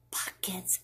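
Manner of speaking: hushed whisper. Acted. Child whispering to Mama about the glue. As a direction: whisper it like a secret.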